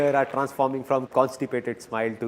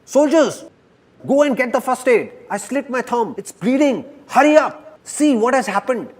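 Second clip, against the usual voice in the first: high, loud panicked orders; king screaming at his soldiers; 9 semitones higher, 3 dB louder than usual. Acted. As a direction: yell for the soldiers in pain. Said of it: "starts screaming".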